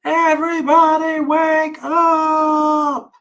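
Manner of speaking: long, high wake-up call held on every syllable; high drawn-out shout. Acted. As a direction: shout it slowly to wake the whole house. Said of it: "and announced".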